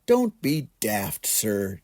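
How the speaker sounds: ordinary delivery, nothing marked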